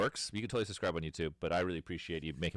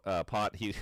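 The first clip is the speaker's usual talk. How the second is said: ordinary talk, nothing marked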